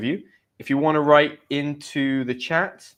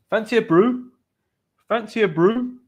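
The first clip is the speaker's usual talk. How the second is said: higher-pitched northern English accent; 9 semitones higher, 4 dB louder than usual. Shown as a demonstration. Teacher demonstrating a tea offer in northern accent. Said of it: "in my northern accent".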